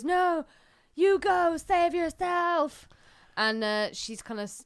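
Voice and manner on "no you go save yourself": higher, louder and slow heroic plea; imitating Sarah Connor. Mother urging her son to escape, in a hammed-up heroic plea. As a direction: plead with him to run, hammed up. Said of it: "And she goes".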